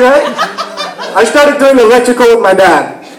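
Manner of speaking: usual speaking voice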